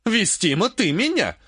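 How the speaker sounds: self-righteous certainty; utterly convinced. A narrator's reading. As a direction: answer with total conviction. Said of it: "убеждённо ответила она".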